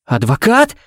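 high, incredulous cracked question; voice breaking. Acted. Father hearing his rights read, voice breaking upward. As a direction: let the voice jump up and break. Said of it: "Голос Коннорса сорвался".

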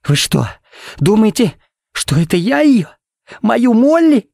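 anguished, very high disbelief; choppy phrases climbing in horror; voice breaking. Acted. Father accused of killing his daughter, voice breaking in disbelief. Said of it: "Голос Коннорса сорвался".

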